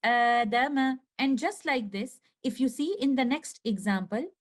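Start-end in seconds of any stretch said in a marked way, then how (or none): 0.0-1.0 Adama recited louder, the opening alif held two counts; recited Quranic word. Shown as a demonstration. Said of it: "the sound will become like this".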